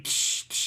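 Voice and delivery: hissy mouth noise of cans cracking open; mouth-made can fizz; imitated beer cans opening. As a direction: imitate cans being popped, "tsh, tsh". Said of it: "the beer cans opening".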